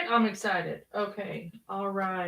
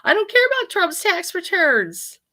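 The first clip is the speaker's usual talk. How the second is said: higher, slow exasperated plea; 9 semitones higher, 4 dB louder than usual. Real, not acted. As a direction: drag it out, fed up. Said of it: "Let me try it again".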